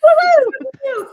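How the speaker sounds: squeaky, sped-up high voice; chipmunk voice. Shown as a demonstration. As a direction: pitch it way up like a cartoon chipmunk. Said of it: "I go into chipmunk mode".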